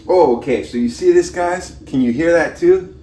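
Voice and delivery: high, eager and bright; thrilled, voice jumping up; excited. Real, not acted. Excited about the boiling pot.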